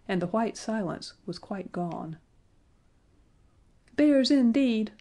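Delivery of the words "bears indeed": high, louder exclamation; shrill cry. A narrator's reading. A dwarf jeers at the children, shrill. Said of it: "cried a shrill voice".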